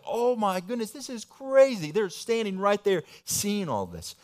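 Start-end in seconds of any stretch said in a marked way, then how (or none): 0.0-1.9 mock stunned exclamation, pitched higher; lightly voiced astonishment; amazed disciples. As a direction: act out the disciples' shock. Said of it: "they're like".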